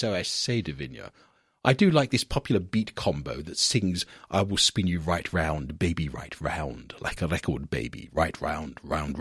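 very low, plummy posh aristocrat. Pretend. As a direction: sing it like an upper-class toff. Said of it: "Singing a very posh voice".

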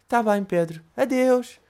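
slow put-on voice of a timid kid; kid Jake. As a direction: ask shyly like a little boy. (Jake, miúdo tímido, voz fingida)

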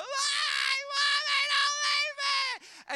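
high, loud, slow wail for mommy; crying out. Retold or imitated. Young son chasing mom's car, crying out loud. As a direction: beg like a panicked child chasing a car.

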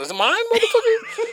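high, laughing squeal of disbelief; half-laughing imitation of my own shock; laughing mock outrage. Retelling the friend's news to his cohost, high laughing disbelief.

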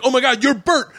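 high, amazed outburst; retold excited recognition. Old rival realizing who the storyteller is, retold excited shock. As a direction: imitate someone suddenly recognizing you, pitch way up.

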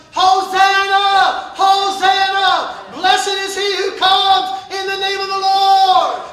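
loud, drawn-out chanted praise; shouted hosanna. Acted. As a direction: cry it out like the crowd.

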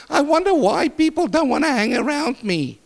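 high, whining mock voice of a complainer; mock whine of a lonely complainer; whiny complainer. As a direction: mimic a self-pitying churchgoer. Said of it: "you come to me and you say".

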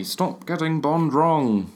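slow, exasperated character impression; Alan Partridge. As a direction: drag it out as a comic character's complaint. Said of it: "my impression of, uh, Alan Partridge".

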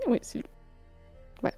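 ordinary talk

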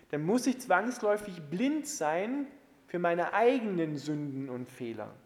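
ordinary delivery

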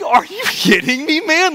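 very high-pitched, hammed-up disbelief; exaggerated astonished protest, pitch shooting up; retold incredulity. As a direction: squeal it in comic outrage at a friend. Acting out his shock at friend.

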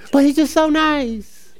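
slow, drawn-out teasing "so nice"; mock sweet tone. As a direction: drag it out, mocking the too-nice guy. Mocking the rival's niceness.

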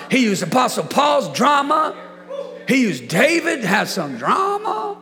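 high, loud, rhythmic pulpit delivery; full-force preaching. Real, not acted. Preacher declaring God turned wrongs to good, full-force preaching. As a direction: drive every phrase home like a fired-up preacher.

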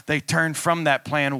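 normal talking voice

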